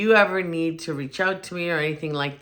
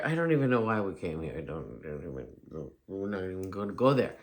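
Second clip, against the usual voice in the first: muttered aside; 7 semitones lower, 6 dB quieter, faster than usual. Real, not acted. Muttered aside about moving.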